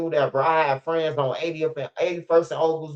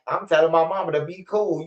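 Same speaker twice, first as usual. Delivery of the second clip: normal talking voice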